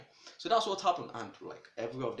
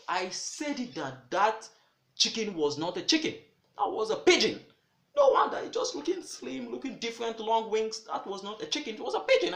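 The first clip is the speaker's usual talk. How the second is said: much higher and louder, astonished; amazed exclamation; 10 semitones higher, 8 dB louder than usual. Retold or imitated. Butcher man shocked the chicken flew, amazed shout. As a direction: cry out in disbelief.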